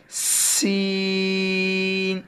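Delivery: سین held long with a whistling s; whistling seen. Shown as a demonstration. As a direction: hiss the s in سین. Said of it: "سیٹی کے ساتھ پڑھے".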